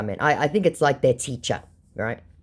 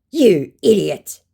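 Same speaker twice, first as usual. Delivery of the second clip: slow, loud, raised insult; mock angry scammer; 5 semitones higher, 7 dB louder, slower than usual. Scammer hitting back at the baiter, mock angry. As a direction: drag it out in mock outrage.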